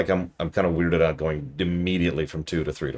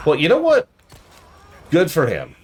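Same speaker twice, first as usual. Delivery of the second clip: mock supportive; 10 semitones higher, 7 dB louder than usual. Mock cheering hiding enemy.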